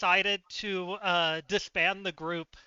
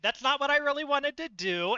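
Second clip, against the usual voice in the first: exasperated protest; 5 semitones higher than usual. Retold or imitated. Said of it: "I'm like".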